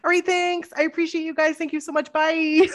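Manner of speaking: high-pitched, polite sign-off; chirpy hasty goodbye. Retold or imitated. Guest bolting off stage, chirpy goodbye. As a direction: thank them in a high voice and flee.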